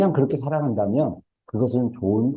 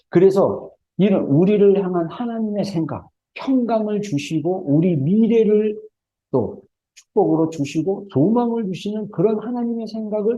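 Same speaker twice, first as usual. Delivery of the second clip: high-pitched, sustained preacher's exhortation; impassioned preaching; 10 semitones higher than usual. Real, not acted. Preacher urging congregation to cry out to God, impassioned preaching.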